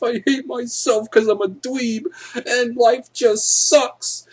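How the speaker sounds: high, loud hammed-up blubbering rant; mock crying. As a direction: wail it like a hurt dweeb in a fake sob. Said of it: "he started to cry".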